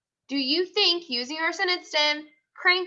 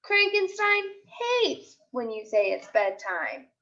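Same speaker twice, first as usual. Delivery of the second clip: put-on lively storybook voice for young kids; picture book read-aloud; slower than usual. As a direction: read it like a class story time. Teacher reads picture book aloud.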